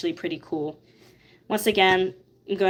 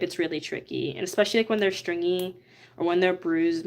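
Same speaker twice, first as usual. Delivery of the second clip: ordinary talk, nothing marked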